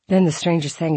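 plain reading voice

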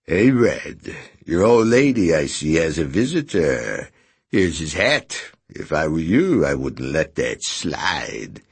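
put-on sneering, nasal old vulture; whiny nasal jeer at the door. A narrator's reading. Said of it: "repulsive, especially under the circumstances, nasal voice".

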